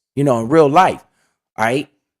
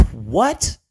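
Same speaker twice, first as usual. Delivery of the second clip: a sharp, much higher "what did"; stunned disbelief; 5 semitones higher, slower than usual. Real, not acted. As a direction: caught off guard, voice jumping up. Son offers money for shares.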